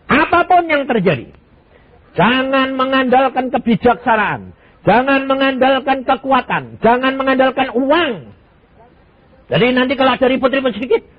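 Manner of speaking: high, loud, hammered sermon cadence; preaching at full force. Acted. Preacher warning the congregation not to rely on wealth, full-force preaching. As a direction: drive each warning home from the pulpit.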